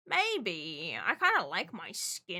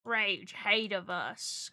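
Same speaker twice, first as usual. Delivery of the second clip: ordinary talk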